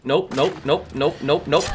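ordinary talk

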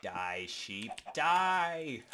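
gleeful shout, high and loud; battle cry. Acted. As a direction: yell it like a maniac slaying sheep. Killing sheep in game.

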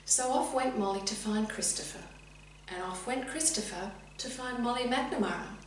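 ordinary delivery, nothing marked

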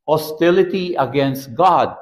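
ordinary delivery

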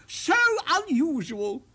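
high, loud, slow royal delight; delighted king. A narrator's reading. King marvels at the giant's pianos, slow and delighted. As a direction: savour each word like a thrilled king. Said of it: "he cried".

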